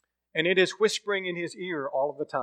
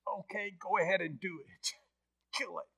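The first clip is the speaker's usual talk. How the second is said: much softer, defeated giving in; resigned surrender; 4 semitones higher, 11 dB quieter than usual. Retold or imitated. Ghost man quietly yields to the angel, defeated.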